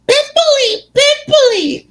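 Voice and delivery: very high, singsong jeer; Peeves's taunt. Retold or imitated. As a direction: chant it in a piercing teasing falsetto. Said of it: "throwing moldy peanuts at her, saying".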